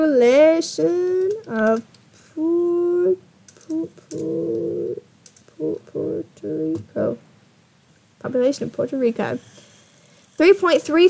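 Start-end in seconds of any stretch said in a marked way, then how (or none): 0.1-7.2 slow, stretched words with halting restarts; absent-minded sounding out. Real, not acted. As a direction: drag out each syllable as if typing it. Sounds out words while searching.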